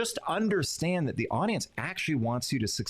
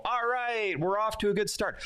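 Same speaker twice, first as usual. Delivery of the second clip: a hint of a high, pleased host; mock self-satisfied emcee; smug emcee; 9 semitones higher than usual. Lightly mocking a speaker pleased with the crowd, smug. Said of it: "And you go".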